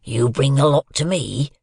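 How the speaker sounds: fearful threat. A narrator's reading. Convict holds the boy upright and gives fearful terms, menacing. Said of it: "went on in these fearful terms".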